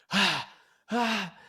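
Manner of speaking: two short, high mock sobs; imitated crying gasps. Teller re-enacting three seconds of crying, mock sobs. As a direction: act out a brief burst of crying. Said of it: "I started crying for, like, three seconds".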